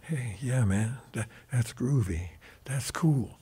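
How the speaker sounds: hushed, easygoing impression of a gentle rocker. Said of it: "he'd be talking like this here".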